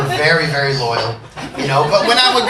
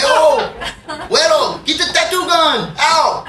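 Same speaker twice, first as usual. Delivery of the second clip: excited homeboy; 8 semitones higher than usual. Retold or imitated. Homeboy friend eagerly calls for the tattoo gun, shouting.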